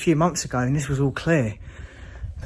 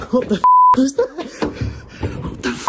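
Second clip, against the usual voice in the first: much higher, louder yell; shocked shout; 10 semitones higher, 6 dB louder, faster than usual. Real, not acted. Explorer startled by noise, shocked shout. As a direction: blurt it out in fright.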